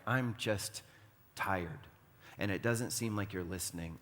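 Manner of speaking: soft, low, worn-out plea; lightly acted discouragement, voice dropped; weary prayer. Worn-out believer praying that God doesn't seem to listen, weary. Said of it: "seasons of life where you're like".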